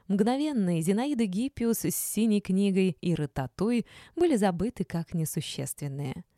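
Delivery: ordinary delivery, nothing marked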